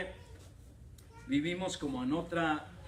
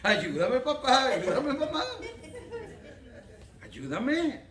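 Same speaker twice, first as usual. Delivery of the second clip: mock pleading; 5 semitones higher, 3 dB louder, slower than usual. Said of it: "como le decían a Carlos".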